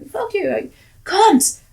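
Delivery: high, loud playful yell; mock scream. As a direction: holler it to your best friend. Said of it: "would scream from one end".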